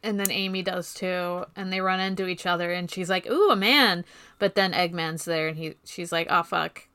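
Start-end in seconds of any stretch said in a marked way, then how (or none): none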